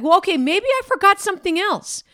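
missionary puzzled that God stays silent; exasperated. Retold or imitated. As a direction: sound like you're scrambling for a reason. Missionary frustrated awaiting God's sign.

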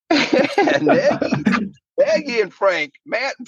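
giggling in a high silly voice; laughing high voice. Retold or imitated. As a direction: laugh through the names.

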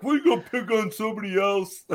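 high, far put-on whiny voice; mocking the angry dock owner. Retelling how the dock owner yelled at them. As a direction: squeak it out like a grumpy homeowner. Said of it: "He's like".

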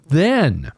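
"then" drawn out with a falling tune; emphasis on THEN. Real, not acted. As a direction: land hard on the word "then". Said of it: "I emphasize the word then".

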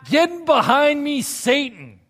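high, loud, slow rebuke; Jesus rebuking Peter; stern rebuke. Acted. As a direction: cut him down hard.

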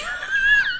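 high shrieking burst of laughter. Real, not acted. Host cracks up at her own porn-channel joke, shrieking laugh. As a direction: crack up into a squeal.

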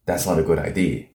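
firm statement, "idea" falling at the end; downward inflection. Shown as a demonstration. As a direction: state it softly and let "idea" drop. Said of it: "But if I just say it as".

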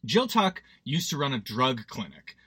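ordinary talk, nothing marked

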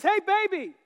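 husband imitating his wife's loud, adoring praise. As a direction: ham up a doting wife praising her husband. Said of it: "She comes and she says".

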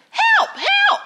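put-on very high, loud child's cry for help; children's voices. Frightened children out on the lake, shrill children's shouts. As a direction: shriek it like children in danger. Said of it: "children's voices".

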